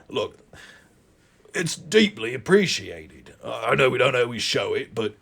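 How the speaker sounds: finally mean it, gently; sincere reply. Acted. Thomas replying softly.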